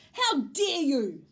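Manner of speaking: loud, slow, outraged shout; angry shout. A narrator's reading. Miss Alma, soaked by the hose, shouts angrily. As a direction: shout it, drawing out each word. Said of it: "she shouted".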